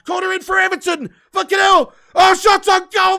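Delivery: ordinary talk, nothing marked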